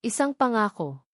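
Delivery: usual speaking voice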